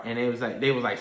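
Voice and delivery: normal talking voice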